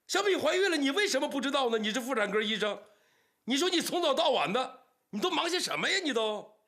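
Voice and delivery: high, loud, angry tirade; shouted rebuke; furious scolding. Acted. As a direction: tear into him with blame. Father scolds son-in-law.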